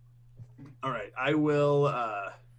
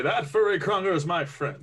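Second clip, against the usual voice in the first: heroic; 6 semitones higher, 5 dB louder than usual. Acted. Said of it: "I heroically run up and say".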